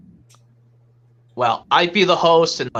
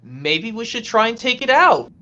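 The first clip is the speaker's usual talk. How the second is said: higher, unsure character voice; hesitant cartoon character; 4 semitones higher than usual. Friend unsure about taking it out, acted character voice. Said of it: "why don't we do a quick impression".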